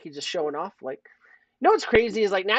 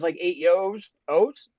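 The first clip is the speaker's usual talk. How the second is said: ordinary talk, nothing marked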